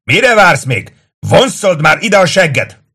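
high, loud impatient yell; bellowing. A narrator's reading. Tullius to a dawdling Kato, bellowing. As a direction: yell at him to hurry. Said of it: "üvöltötte Tullius".

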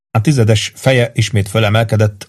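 plain reading voice

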